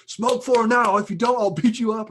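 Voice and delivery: loud, high furious threat; Tony raging at the guy to smoke; mock rage. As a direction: roar the threat down at him. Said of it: "uncontrolled rage".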